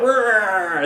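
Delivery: high, drawn-out growl; imitated slow-motion "rrr", sliding down; mock effort roar. Reviewer imitating Samson in slow motion, high mock growl. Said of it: "He's like".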